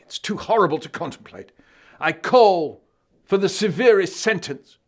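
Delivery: high, loud oratorical prosecutor; the dog prosecutes the accused, loud and grand. Acted. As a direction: thunder the case against the villains. Dog's prosecution speech.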